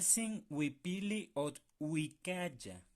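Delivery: huipili, ot, huicaya muy despacio, sílaba por sílaba; sílabas lentas. Shown as a demonstration. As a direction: cada sílaba bien separada y lenta. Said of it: "Cuaj, cual, sin". (sílabas lentas y marcadas)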